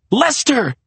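high, urgent exclamation; frantic answer. A narrator's reading. Maria dragging Serena inside, frantic answer. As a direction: fire back the name in alarm.

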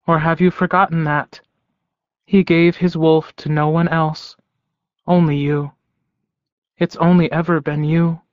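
a lifted character voice, calmly insistent; lightly voiced woman. A narrator's reading. Woman reminds Ox that he was chosen, lightly voiced and earnest.

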